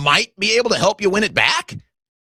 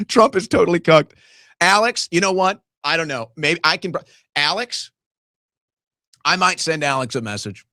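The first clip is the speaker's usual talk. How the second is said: ordinary talk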